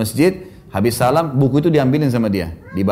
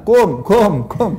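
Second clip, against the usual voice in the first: put-on higher, short toddler syllables; imitated toddler greeting; 5 semitones higher, much slower than usual. Toddler greeting passing worshippers after mosque, imitated childish chirp. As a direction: mimic a little child's cut-down salam. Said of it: "dia yang bilang".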